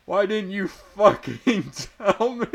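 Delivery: high shouted outburst, laughing through it. A narrator's reading. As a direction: hurl the question at him, high and loud. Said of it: "he shouted".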